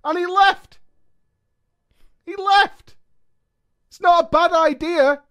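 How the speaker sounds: very high, loud exclamations of disbelief; incredulous outburst. Real, not acted. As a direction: cry out in loud, high-pitched protest. Outraged reaction to the breakup.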